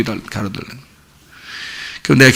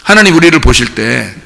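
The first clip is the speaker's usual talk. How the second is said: ordinary talk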